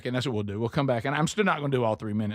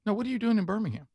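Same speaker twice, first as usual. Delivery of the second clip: pitched way up and rushed, baffled; puzzled retold question; 8 semitones higher, faster than usual. Stranger in Birmingham restaurant asks why he's there, baffled and high. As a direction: ask it fast, high and bewildered.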